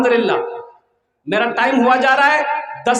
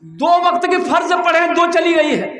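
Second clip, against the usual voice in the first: higher, exasperated scolding; angry outburst; 6 semitones higher than usual. Acted. Exasperated farmer threatens the bawling buffalo calf, angry. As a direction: snap at the noisy calf, voice pitched up. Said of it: "इसको गुस्सा आया".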